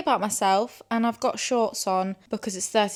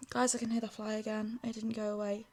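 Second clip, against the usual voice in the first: softer, resigned tone; quiet; 8 dB quieter than usual. Real, not acted. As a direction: say it quietly, deflated. Repeats it quietly.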